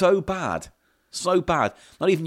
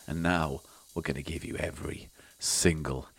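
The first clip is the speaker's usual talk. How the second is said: whispered, teasing, softer; mock sexy whisper; 9 semitones lower, 8 dB quieter than usual. Host teases the audience in a mock sexy whisper. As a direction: breathe it like a tease.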